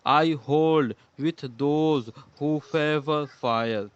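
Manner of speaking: very slow, each O stretched long; teacher reading the poem line slowly for assonance. Shown as a demonstration. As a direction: read the line slowly to show the repeated O. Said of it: "ओ का sound को बार-बार repeat किया गया है".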